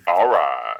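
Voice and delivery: sassy drawn-out all right. Shown as a demonstration. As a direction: say it with attitude.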